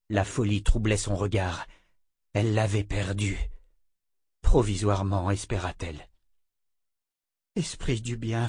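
ordinary delivery

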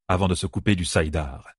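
usual speaking voice

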